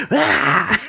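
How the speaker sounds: high mock-attack cry; playful lunging roar, like a bear; mock grizzly roar. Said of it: "like a grizzly bear".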